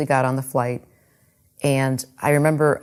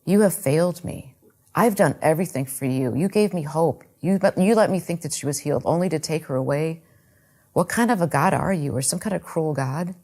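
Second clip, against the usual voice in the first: ordinary delivery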